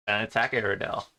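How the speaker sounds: ordinary delivery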